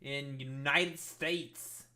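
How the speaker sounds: slow hammed-up New Jersey accent. Reading the next event city in a mock New Jersey accent. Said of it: "if that's how you do the accent".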